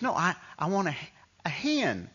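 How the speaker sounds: high Southern twang, insisting; heavy Mississippi accent. Retold or imitated. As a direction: imitate a cartoonish Mississippi mom trying to be understood. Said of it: "Southern Mississippi accent".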